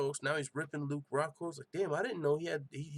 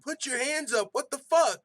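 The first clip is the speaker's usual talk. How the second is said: high, loud yell, hammering the last word; exasperated high-pitched shout; screaming; 12 semitones higher, 9 dB louder than usual. Retold or imitated. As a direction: reenact yelling at the screen in disbelief. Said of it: "I was literally screaming".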